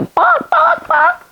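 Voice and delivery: very high squawk of a hen. Acted. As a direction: squawk it like a startled chicken. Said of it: "See her squawking there?".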